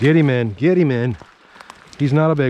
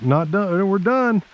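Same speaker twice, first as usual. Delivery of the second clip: mock boast; 8 semitones higher, slower than usual. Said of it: "I was like".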